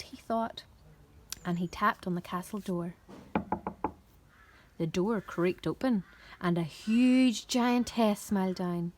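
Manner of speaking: plain reading voice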